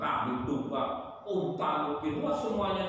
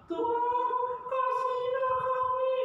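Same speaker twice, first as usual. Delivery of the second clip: put-on child's plea. A child in Nineveh begging God, very high sung plea. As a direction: sing it out like a small child begging. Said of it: "Mungkin anak-anak ngomongnya".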